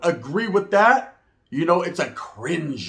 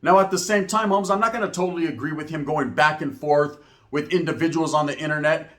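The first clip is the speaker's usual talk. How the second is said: ordinary talk, nothing marked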